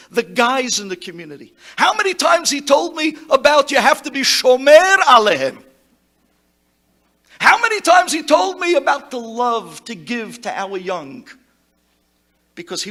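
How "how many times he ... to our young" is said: rising preaching fervour. Real, not acted. Eulogy building to climax.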